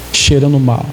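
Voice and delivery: normal talking voice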